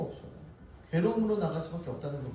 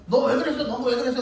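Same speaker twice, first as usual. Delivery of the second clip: mock interrogation, higher and louder; lightly acted telling-off, pitched up; scolding questioner; 7 semitones higher, 4 dB louder than usual. Pastor questioning squabbling children, mock scolding.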